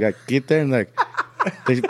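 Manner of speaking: ordinary talk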